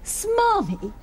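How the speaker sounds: high-pitched, affronted echo; affronted disbelief. Acted. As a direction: repeat the insult in disbelief. Teacher offended at being called smarmy.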